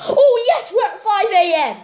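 high joyful shout; excited cry of relief. Real, not acted. Reaching five AM, relief.

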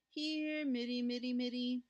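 high, held sing-song cat call. Acted. Mother searching the dark basement for the kitten, calling. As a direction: call a lost kitten in a chanting tone. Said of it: "peering into all the dark corners and calling".

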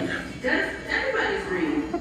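very high, slow squealing panic voice; high squeaky mock freakout over a bug. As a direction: squeal like a startled little critter. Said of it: "You're like".

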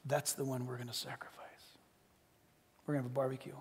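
soft whispered aside; father whispering his feast plan. Acted. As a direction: hush it like a delicious secret. Father's secret about the calf.

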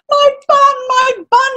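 high-pitched, jubilant rat voice; cry of joy. A narrator's reading. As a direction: exclaim it as a little rat overjoyed. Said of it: "he cried in joy".

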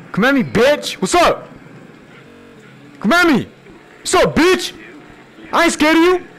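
scared bravado shouting. Real, not acted. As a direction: shout a taunt to hide fear. Taunting enemy, scared bravado.

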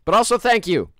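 loud, high mock outrage; yelling. Real, not acted. Streamer yelling at the game's characters in mock outrage. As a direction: shriek at the characters in fake outrage.

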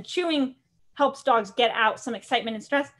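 ordinary talk

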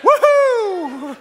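very high, loud woohoo; whooping cheer. Real, not acted. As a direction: let out a long celebratory whoop. Cheers after calling for amen.

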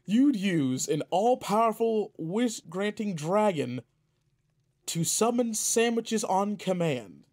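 mock-scandalised at a wish spent on sandwiches; incredulous. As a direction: spell out how absurd it is. Disbelief at wasting a wish.

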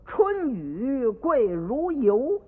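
拖长腔调慢慢吟诗; slow poem recital. Acted. Child recites his poem.

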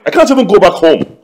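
loud, aggrieved protest; upset. Acted. Upset husband to his laughing friend, loud complaint. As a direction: protest loudly at being laughed at. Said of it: "I'm very, very upset".

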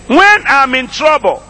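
high preaching voice, broken into short phrases; slow call-and-repeat with long pauses between phrases. Shown as a demonstration. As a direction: proclaim it piece by piece for the congregation to echo. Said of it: "make that a little slower".

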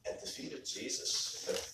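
soft, half-whispered repeat; hushed reverence. Real, not acted. Preacher pondering the feet of Jesus, hushed and reverent. Preacher dwells on phrase softly.

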